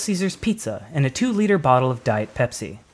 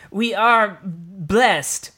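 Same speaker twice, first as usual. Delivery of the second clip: put-on slow, solemn old druid voice, higher; slow elder's proclamation; 6 semitones higher, much slower than usual. A narrator's reading. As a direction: intone it like an ancient elder. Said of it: "said Hankatar".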